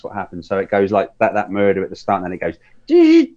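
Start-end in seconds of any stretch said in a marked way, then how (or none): 2.9-3.3 sudden high shriek of a horror scream; movie scream. Retold or imitated. Imitating the Scream opening for friends, high shriek. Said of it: "you know, the scream".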